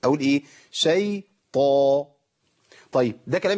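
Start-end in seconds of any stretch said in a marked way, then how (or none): 0.7-2.0 شيطان with a thick emphatic t and held dark aa; stretched شيطان, full emphatic taa; heavy taa. Shown as a demonstration. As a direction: slowly pronounce شيطان with a strong heavy t. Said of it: "أقوى صوت للطاء".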